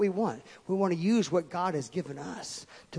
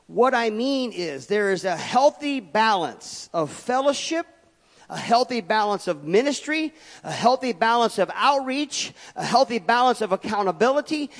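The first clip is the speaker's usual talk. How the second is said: preaching at full force; 5 semitones higher, 8 dB louder than usual. Acted. Pastor listing what small groups give, preaching loud. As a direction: drive each healthy balance home.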